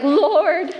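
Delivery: a retold tearful cry of Lord, only lightly done; mock ugly crying. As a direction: imitate your own ugly crying, lightly. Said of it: "I was crying ugly tears".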